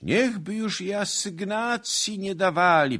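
very high, loud whining; wailing complaint. A narrator's reading. Townsmen tearfully complaining to the commander, high wailing. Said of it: "Kiedy to nie zaczną plakać?".

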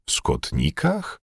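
slow, hushed question, rising; astonished. A narrator's reading. Bugaj echoes the steward's question, astonished. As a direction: repeat it softly in disbelief. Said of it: "zdumiał się Bugaj".

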